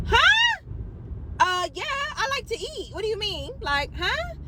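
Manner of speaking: high, startled huh that turns into confused questions; baffled. Retold or imitated. Friend reacting to the man on the phone, high and baffled. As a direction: play it dumb and shocked, pitch jumping up.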